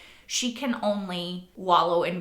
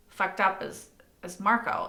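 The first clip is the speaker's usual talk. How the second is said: normal talking voice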